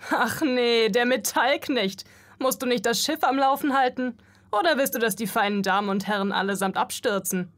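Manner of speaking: loud, high, sneering taunt; mocking jeer. A narrator's reading. Dock worker taunting the metal servant, loud mocking sneer. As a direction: jeer at him like a bully with an audience. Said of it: "Sie schauten auf und lachten".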